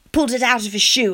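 ordinary delivery

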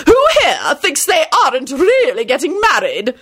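high, theatrical screech; piercing, put-on demand; screeching lady. A narrator's reading. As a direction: screech it out to the whole table. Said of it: "Lady Fanshawe screeched".

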